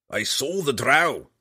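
a slow dwarf growl; father growling at his daughter. Acted. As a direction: growl it at her in suspicion. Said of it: "Bruner growled at her".

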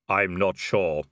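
slow, low, otherworldly Death; drawn-out reaper musing; grim reaper voice. Acted. Death speaking.

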